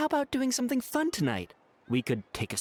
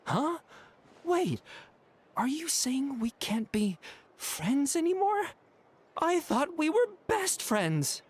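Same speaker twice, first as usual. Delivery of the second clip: high-pitched, stunned and hurt; stunned, wounded protest in a raised pitch; hurt disbelief; 8 semitones higher than usual. Acted. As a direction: react as if blindsided by a friend. Friend suddenly ends the friendship.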